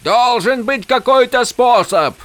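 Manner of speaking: very high, straining cry; high, frantic protest; desperate shout. A narrator's reading. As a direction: refuse to accept it, crying out. Desperate plea shouted at storm.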